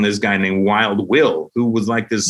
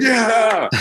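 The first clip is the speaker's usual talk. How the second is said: crowd cheer; 12 semitones higher than usual. Retold or imitated.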